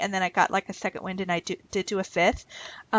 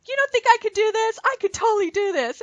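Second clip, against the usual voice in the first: much higher, mock offended protest; indignant; 13 semitones higher, 3 dB louder than usual. Protesting to her laughing husband, mock offended high voice. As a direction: insist you can do it, half playful. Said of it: "I'm like".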